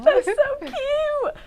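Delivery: very high, slow, stretched squeal; squealing coo. Real, not acted. Host squealing to co-host over the ghosties, high drawn-out coo. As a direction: gasp then gush like seeing a puppy.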